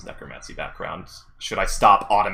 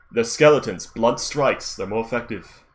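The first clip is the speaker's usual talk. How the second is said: mild battle yell, louder at the start; acted yell; 3 semitones higher, 6 dB louder than usual. Player voices Morris warning about skeletons, acted yell. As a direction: call a tip out to the party. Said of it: "Morris would like to yell out".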